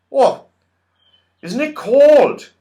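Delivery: high, loud, slow odd voice; shivering Saucepan Man. A narrator's reading. Saucepan Man cold without his pots, high and shivery. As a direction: shiver through the words. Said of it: "said the Saucepan Man, shivering".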